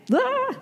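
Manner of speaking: high startled "ah" of mock panic; mock panic yelp. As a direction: yelp in a sudden fake fright. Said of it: "I panic".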